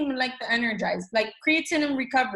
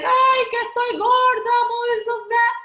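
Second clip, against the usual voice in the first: much higher and louder, whiny best friend moaning; complaining best friend; 11 semitones higher, 4 dB louder than usual. Retold or imitated. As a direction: play the friend who always moans about her weight. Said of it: "best friend that's always like".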